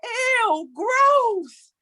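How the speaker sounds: mock disgusted kids. Kids' disgusted reaction imitated.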